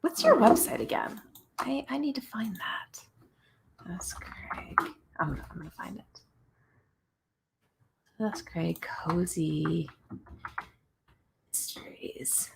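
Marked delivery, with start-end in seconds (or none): 1.6-12.5 whispering. Real, not acted. Muttering while searching her website.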